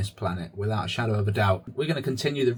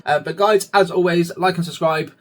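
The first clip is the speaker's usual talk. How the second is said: raised, energetic sign-off plea; loud call-out; 5 semitones higher, 6 dB louder than usual. Real, not acted. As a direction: call out to the viewers. Call to viewers to subscribe.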